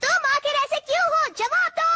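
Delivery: high, rapid-fire challenge; angry demand. Acted. Robot friend demands an answer from the boy, angry. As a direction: demand it fast, pitch right up. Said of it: "इतना गुस्से में क्यों हो".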